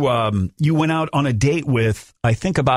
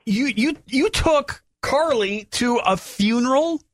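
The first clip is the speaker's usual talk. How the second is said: incredulous; 11 semitones higher, slower than usual. Real, not acted. Host incredulous at Tom.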